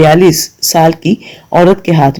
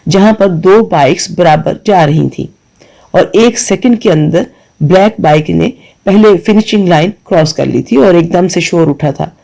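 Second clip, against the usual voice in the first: usual speaking voice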